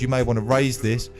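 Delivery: ordinary talk, nothing marked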